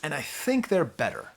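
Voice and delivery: usual speaking voice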